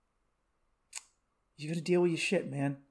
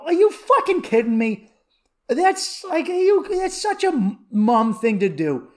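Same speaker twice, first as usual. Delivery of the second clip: retold outburst, pitched high and getting loud; worked-up mock outrage; indignant; 6 semitones higher, 5 dB louder than usual. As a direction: act out exasperated disbelief at mom. Retells indignant reply to mom.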